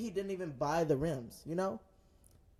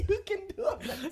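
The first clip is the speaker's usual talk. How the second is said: laughing; 14 semitones higher, faster than usual. Real, not acted. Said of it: "laughing at friend".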